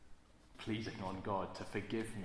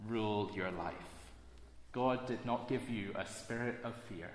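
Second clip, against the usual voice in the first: ordinary delivery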